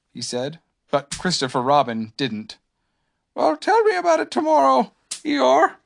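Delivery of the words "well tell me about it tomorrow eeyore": high-pitched, louder bear voice; high storybook voice, only lightly sleepy; sleepy Pooh. A narrator's reading. Pooh puts off a night visitor, high sleepy voice. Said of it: "said Pooh sleepily".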